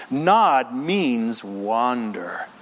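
ordinary talk

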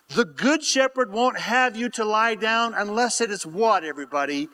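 ordinary talk

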